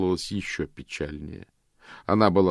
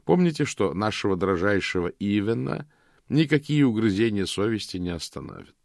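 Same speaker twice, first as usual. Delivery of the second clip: plain reading voice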